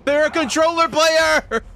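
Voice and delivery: high, loud exclaim; amazed outburst. Real, not acted. Streamer telling chat, stunned by the opponent. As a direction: shout the discovery in disbelief.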